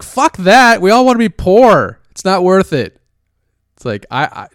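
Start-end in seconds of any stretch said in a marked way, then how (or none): 0.0-3.0 mocking crypto crowd. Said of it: "they're like".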